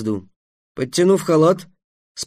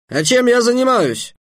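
rude retort; 9 semitones higher, 3 dB louder than usual. A narrator's reading. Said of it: "очень невежливо ответил самаршанец".

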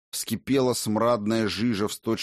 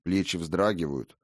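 ordinary delivery

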